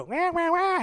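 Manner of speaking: high mock infant "wah, wah, wah"; baby crying. Preacher imitating a baby crying, high mock wail.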